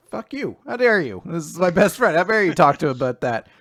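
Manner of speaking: acted indignation. Acts out hero's indignant retort.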